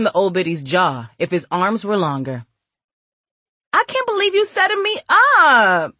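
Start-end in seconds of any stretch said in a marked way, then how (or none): 3.7-5.9 very high wail ending loud; repeated outraged scream. A narrator's reading. Girl rubbing her banged head, high outraged wail. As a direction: cry out in high-pitched disbelief. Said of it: "she repeated, rubbing her head".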